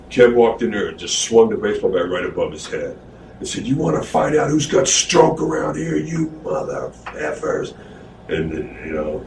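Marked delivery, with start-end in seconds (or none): 3.7-7.7 higher put-on tough-guy threat; menacing Kev. Kev swinging a baseball bat at the locker room, menacing voice.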